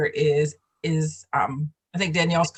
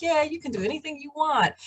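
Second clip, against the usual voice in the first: high breezy mock cheer; mocking upbeat presenter; 10 semitones higher than usual. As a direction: parody a glib presenter. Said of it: "we've had presenters come out and it's like".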